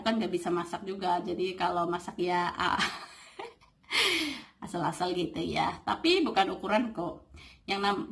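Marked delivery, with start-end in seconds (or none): 3.9-4.4 short, high, falling laugh; self-deprecating laugh. Real, not acted. Admitting she can't cook, self-deprecating laugh. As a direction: laugh briefly at your own shortcomings. Laughs at her own poor cooking.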